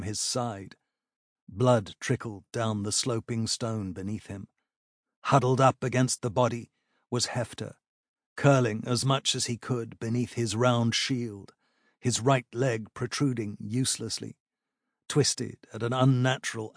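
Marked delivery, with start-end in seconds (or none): none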